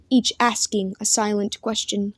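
usual speaking voice

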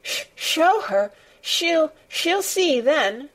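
put-on high, stammering, distressed boy; broken phrases, pitched far up and drawn out; gasped between sobs. A narrator's reading. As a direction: blurt it haltingly through tears. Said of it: "he gasped out between two sobs".

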